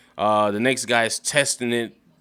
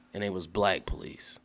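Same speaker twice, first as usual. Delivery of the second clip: hushed aside; 3 semitones lower, 8 dB quieter than usual. Real, not acted. Dropping voice on a punchline.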